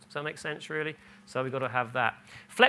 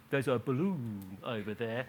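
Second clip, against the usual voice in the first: "There's a balloon over there" said quieter and lower, flat, not bright; flat aside; 4 semitones lower, 6 dB quieter than usual. Pretend. Imagined parent to a teenager, quiet flat aside. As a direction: mutter it as a dry joke. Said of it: "I'm not saying you say to a seventeen-year-old".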